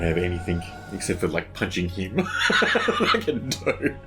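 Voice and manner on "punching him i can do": usual speaking voice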